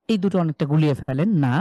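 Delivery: usual speaking voice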